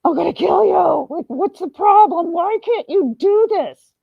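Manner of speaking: half-laughing fake fury at the kids; mock furious parent. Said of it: "You're like".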